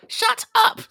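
piercing, very high-pitched shout; shouting teacher impression. Mimicking a teacher silencing the class, high shriek. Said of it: "used to just tell the class to shut up all the time".

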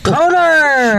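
high, drawn-out holler of a name; mock yell. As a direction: call it out like a hammy movie star. Joining in the yelly impressions.

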